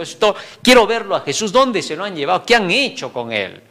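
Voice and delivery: ordinary talk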